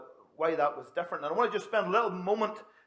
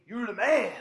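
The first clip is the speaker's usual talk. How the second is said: lightly voiced tough-guy catchphrase, a bit higher and louder; a hint of a Mister T swagger; 5 semitones higher, 5 dB louder than usual. Preacher quoting a catchphrase to the congregation, a light Mister T impression. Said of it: "it made me think of Mister T".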